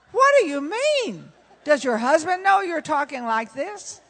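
high, loud mock-shocked yell; shocked outburst. Man on the plane shocked at the pastor's wife, hammed-up loud outburst. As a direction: play the scandalised stranger, hammed-up.

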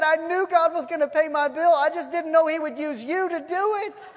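loud, high-pitched jubilant retort; excited. Acted. Lady answers the neighbor who paid, excited. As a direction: crow it with rising excitement. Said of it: "getting excited".

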